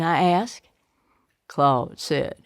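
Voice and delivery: plain reading voice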